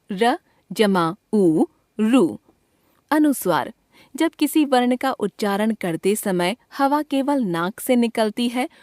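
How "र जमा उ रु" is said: slowly, syllable by syllable; slow spelling out. Shown as a demonstration. Teacher spelling out a syllable for pupils, slowly. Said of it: "समझते चलें".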